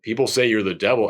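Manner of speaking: low-pitched acted film dialogue; deacon confronting devil. Reviewer retelling the deacon facing the old man, low. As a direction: play the questioner low and restrained. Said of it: "He's like".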